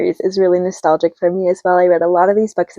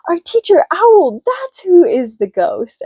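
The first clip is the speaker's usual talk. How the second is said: surprised realization; 10 semitones higher, slower than usual. Retold or imitated. Franklin discovers the ghost.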